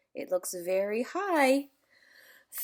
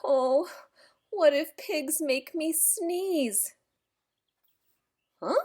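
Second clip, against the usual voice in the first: ordinary delivery, nothing marked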